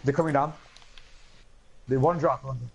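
usual speaking voice

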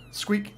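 high-pitched squeak; toy squeak. A narrator's reading. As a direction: squeak it like a rubber chew toy. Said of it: "The rubber toy squeaks eerily".

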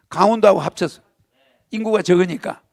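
ordinary talk, nothing marked

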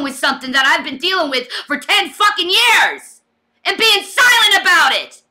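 exasperated outburst; angry venting. Real, not acted. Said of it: "But I'm gonna vent".

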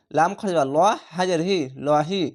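normal talking voice

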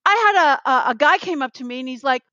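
ordinary talk

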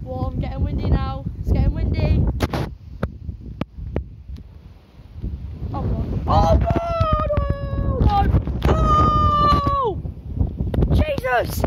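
high loud cries in a gale; shouting over roaring wind; caught in strong wind. Real, not acted. Getting windy now, blown about.

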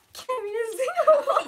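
laughing while teasing; high laughing tease. Real, not acted. Teasing friend's handwriting.

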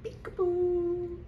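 very slow high teasing peekaboo; peekaboo singsong. Pretend. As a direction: drawl it out like to a baby. Playful peekaboo to friend.

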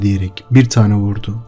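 ordinary delivery, nothing marked

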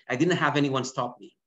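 usual speaking voice

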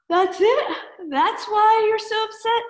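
put-on high, loud disbelieving girl through yawns; a sleepy girl surprised it's so small; high girl voice between yawns; yawning Schluffy Girl. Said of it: "asked Schluffy Girl between yawns".

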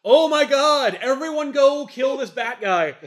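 high, loud, slow outraged yell; mock-furious villain yelling at his troops; exasperated villain shout. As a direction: bark the order like a furious alien boss. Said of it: "Protex is like".

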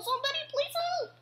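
high, fast squeaky cry for help; tiny mouse begging for help; panicked plea. Acted. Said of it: "Then softly he growled".

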